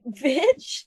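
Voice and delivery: retold baffled, questioning insult with rising tune; confused retort. Shopper throwing the purse back at her, confused retort. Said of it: "'cause I was so confused".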